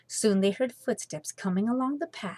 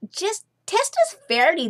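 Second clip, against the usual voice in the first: higher, louder pleading exclamation; exclaiming; 11 semitones higher, 7 dB louder than usual. Acted. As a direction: burst out, insisting. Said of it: "they exclaimed".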